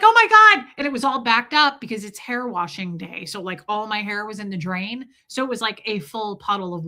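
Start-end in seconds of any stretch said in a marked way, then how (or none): 0.0-0.7 loud, high cry of alarm; hammed-up horror at a drowning phone; mock panic. Phone drops into the water.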